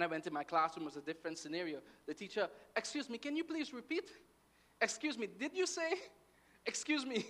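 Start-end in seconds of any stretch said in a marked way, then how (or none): 2.8-7.3 high-pitched polite pleading questions; puzzled pupil. Retold or imitated. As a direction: play a lost student asking the teacher to repeat. Acting out asking the teacher to repeat.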